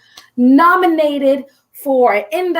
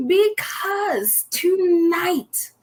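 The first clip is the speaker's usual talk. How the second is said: slow, higher, stretched "tonight" with a break of feeling; drawn-out tonight; 6 semitones higher, 3 dB quieter, much slower than usual. Acted. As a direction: stretch "tonight" out slowly and high, as if close to tears. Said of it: "I just might cry today".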